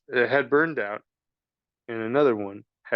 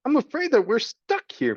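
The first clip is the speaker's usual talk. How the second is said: much higher call; shouted; 12 semitones higher than usual. Acted. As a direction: call out in dismay. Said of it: "shouted the princess".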